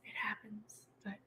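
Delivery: whisper. Real, not acted. Resigned aside.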